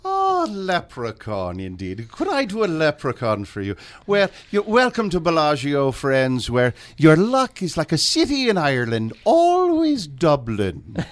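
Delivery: a hammy leprechaun with a lilt. Pretend. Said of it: "while sounding like a leprechaun".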